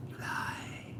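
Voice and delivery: unvoiced whispered accusation. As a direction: hiss it close like a secret. Teasing accusation.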